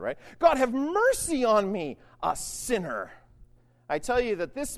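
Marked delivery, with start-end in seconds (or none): 0.4-3.1 slow, raised plea, falling at the end; fervent, drawn-out cry for mercy; anguished tax collector. Acted. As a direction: plead slowly as a penitent. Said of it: "beat on his breast and said".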